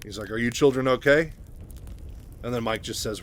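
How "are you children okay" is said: raised voice, projecting into the room; calling out. Acted. Raynor calling to the children in the command center, loud. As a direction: call out to check on them.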